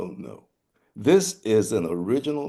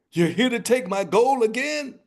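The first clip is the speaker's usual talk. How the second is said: high, squeaky rooster voice; cocky rooster; 5 dB louder than usual. Acted. Rooster taunting the riders, high and squeaky. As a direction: chirp it up like a smug little bird.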